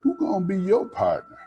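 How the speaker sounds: put-on high, fast nosy girl; teasing Orlinda. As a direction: sidle up and needle her, high and quick.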